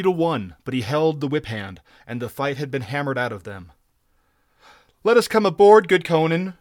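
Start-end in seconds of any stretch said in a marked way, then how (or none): 5.0-6.5 high, loud begging whine; pleading pirate, pitched up and loud; whining plea. A narrator's reading. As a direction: beg to be let in. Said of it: "whined a red-sashed Cimmerian".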